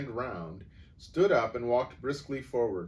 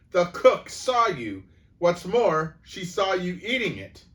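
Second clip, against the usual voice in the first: angry accusation; 10 semitones higher, 11 dB louder than usual. A narrator's reading. Headmistress presenting the witness, angry. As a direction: hammer the proof home.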